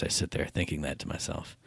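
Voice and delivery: normal talking voice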